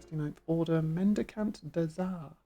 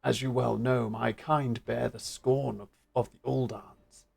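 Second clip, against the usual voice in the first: big burly beast man; 6 semitones lower than usual. Acted. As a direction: speak it like a huge hulking beast. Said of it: "Big burly beast man".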